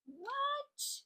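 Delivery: high, squeaky "What?"; a startled, high yelp of mock shock. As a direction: gasp it out in fake disbelief. Reacts to tattoo ban.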